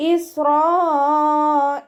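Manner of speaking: slow chanted Isra'il, long drawn vowels; Quran recitation demo. Shown as a demonstration. Teacher models ഇസ്രായേൽ for students, slow recited. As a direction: intone ഇസ്രായേൽ slowly and high like tajweed recitation. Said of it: "ഇസ്രായേൽ എന്ന് ഉച്ചരിക്കരുത്".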